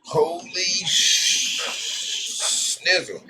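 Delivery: high, slow shocked gasp of a curse. Real, not acted. Vlogger tasting too-sweet tea, high shocked cry. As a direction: react to way too much sugar.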